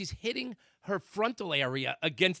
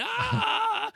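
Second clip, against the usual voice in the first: long, high, loud "aah"; imitated scream; 7 dB louder than usual. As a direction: scream in mock horror, drawn out. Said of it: "I'm screaming".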